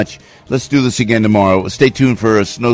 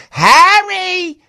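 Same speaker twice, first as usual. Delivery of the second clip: high, drawn-out mock holler; high-pitched playful call. Calls out to Harry in ad.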